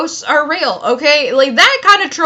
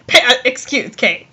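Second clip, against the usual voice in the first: usual speaking voice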